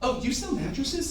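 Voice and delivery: high, soft questioning voice; mock-innocent rising question; surprised guest. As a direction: lift it high, lightly, as a puzzled customer.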